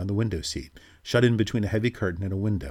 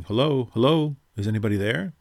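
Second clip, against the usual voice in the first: loud, higher calling out hello; shouting; 5 semitones higher, 5 dB louder than usual. A narrator's reading. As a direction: call out to find them in the dark. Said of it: "young Reggie's voice shouting".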